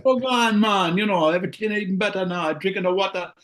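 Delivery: joking Jamaican lilt; Jamaican accent. Acted. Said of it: "I'm starting to speak with a Jamaican accent".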